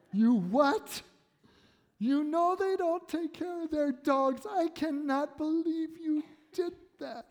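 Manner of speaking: high, indignant wife voice, far above own; wife scolding. Retold or imitated. Wife scolding over given dog.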